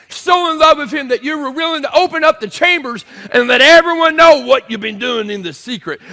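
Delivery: high, loud, breathless-pulpit fervor; impassioned shouting king. Acted. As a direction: pour it out to Daniel at full voice. Preacher acts king crying to Daniel.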